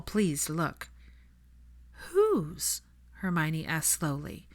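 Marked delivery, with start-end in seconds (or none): 2.1-2.8 sharply high, surprised; startled question. Acted. Hermione asks Scorpius, high and startled. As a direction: ask in sudden shock. Shocked question about brother.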